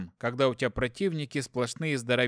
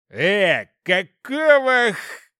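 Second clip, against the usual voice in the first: loud, high, roaring demon; growling demon voice; 7 semitones higher, 9 dB louder, much slower than usual. Acted. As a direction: bellow it like a startled demon. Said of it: "Раздался изнутри рыкающий голос демона".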